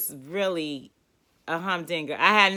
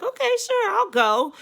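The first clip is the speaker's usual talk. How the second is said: fake agreement; 14 semitones higher, 4 dB louder than usual. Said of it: "I'm like".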